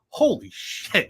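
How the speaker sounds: slow, drawn-out amazed exclamation. Retold or imitated. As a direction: let it out slowly in disbelief. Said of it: "I was like".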